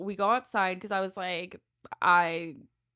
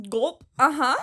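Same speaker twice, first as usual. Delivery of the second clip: a sharp, louder mock gulp with a nervous uh-huh; 4 semitones higher, 8 dB louder than usual. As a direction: swallow hard and squeak uh-huh. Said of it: "I was like".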